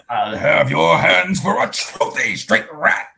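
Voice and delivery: slow, drawn-out menacing threat; put-on cartoon heavy voice; character line impression. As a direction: quote it like a slow-talking film villain. Quoting a film character's line.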